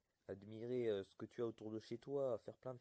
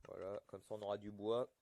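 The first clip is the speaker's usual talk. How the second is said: ordinary talk, nothing marked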